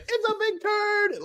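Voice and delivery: high, drawn-out imitation of a little girl; high-pitched mock child announcement; Jenny. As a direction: squeal it slowly like a proud kid. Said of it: "to a video of little Jenny saying".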